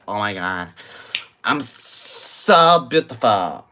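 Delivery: put-on preening voice, dragging out the end; mock self-adoring gush; exaggerated affected swoon, slowing down; mocking "oh my God" voice. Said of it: "have this, like, "Oh my God" voice".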